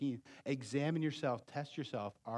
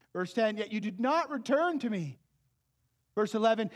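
loud, high fervent preaching; preaching at full force; 13 semitones higher, 11 dB louder than usual. Acted. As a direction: drive the warning home to the congregation. Preaches to the congregation.

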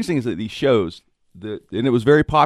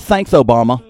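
imitating cousin Victor; 3 semitones higher, 11 dB louder than usual. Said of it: "He goes".

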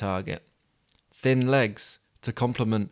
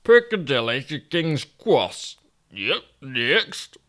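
higher Yorkshire voice, indignant; a put-out northern man, lost in London; Toby grumbling in Yorkshire voice; grumpy Yorkshireman Toby; 4 semitones higher, 3 dB louder, slower than usual. Acted.

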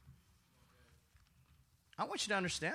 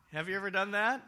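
ordinary talk

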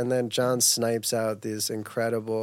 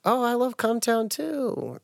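high, slow fake cheer; mock enthusiasm; 9 semitones higher, slower than usual. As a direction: gush it like you don't mean it. Mocking own fake enthusiasm.

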